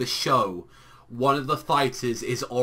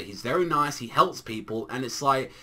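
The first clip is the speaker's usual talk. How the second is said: ordinary talk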